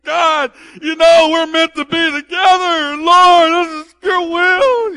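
high, loud, slow hollered prayer; screaming prayer. Retold or imitated. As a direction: bellow it so the whole hallway hears. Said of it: "he's screaming out".

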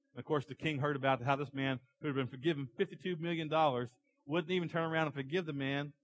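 ordinary talk, nothing marked

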